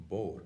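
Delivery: bór said in one short syllable; Connacht short form. Shown as a demonstration. Teacher showing the short Connacht way of saying it. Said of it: "the pronunciation".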